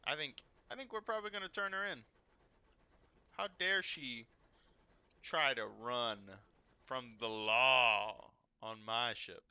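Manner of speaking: captain huffing theatrically about a fugitive on his ship; mock outrage.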